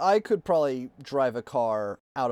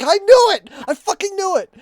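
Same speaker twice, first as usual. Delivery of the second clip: loud, high shout of triumph; triumphant shout; 11 dB louder than usual. Retold or imitated. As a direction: yell it like you were right all along. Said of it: "He's like".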